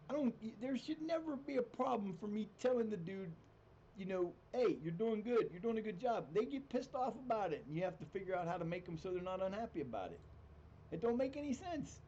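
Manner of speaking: high-pitched, incredulous complaint; exasperated rant. Real, not acted. Venting about players' unreasonable reactions.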